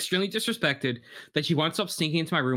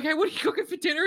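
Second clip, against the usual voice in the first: put-on chirpy teen quote; 11 semitones higher, faster than usual. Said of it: "We're like".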